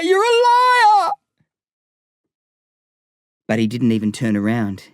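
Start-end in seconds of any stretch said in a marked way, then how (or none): 0.0-1.1 slow, breaking scream into tears, far higher; boy sobbing the final scream after Lyle; crying scream. Acted. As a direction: let the last liar collapse into crying. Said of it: "screaming so loud it hurt my throat".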